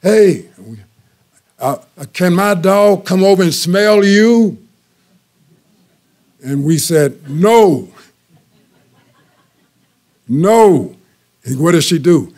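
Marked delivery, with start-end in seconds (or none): 0.0-4.6 higher and louder, calling out across the road; calling across the street. Retold or imitated. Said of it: "she yelled over". 7.3-10.9 louder, higher firm refusal. Retold or imitated.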